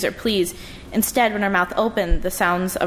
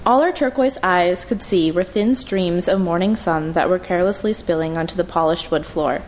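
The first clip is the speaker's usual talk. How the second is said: usual speaking voice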